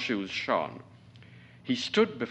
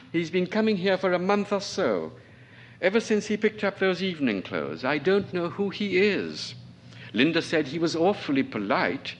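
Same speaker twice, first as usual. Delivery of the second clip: raised, voiced apart from the narration; character voice; 8 semitones higher than usual. A guest explains the colonel's habits, lightly voiced. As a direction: play the guest talking about the colonel.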